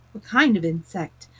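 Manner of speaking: ordinary delivery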